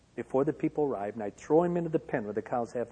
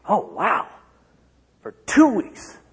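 put-on higher, louder boyish wonder; childlike excitement; 7 semitones higher, 10 dB louder, slower than usual. Boy awed that they will move a whole house, excited. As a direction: relive a kid's thrill at the big move. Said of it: "I lived with excitement".

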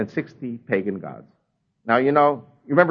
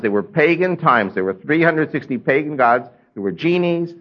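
normal talking voice

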